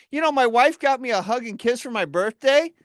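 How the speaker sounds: usual speaking voice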